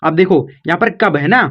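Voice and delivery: usual speaking voice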